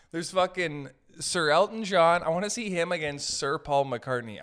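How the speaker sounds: ordinary talk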